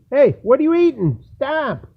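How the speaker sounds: loud high sharp call; yelling at dog. Real, not acted. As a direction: shout to stop the dog eating. Dog eating something in snow.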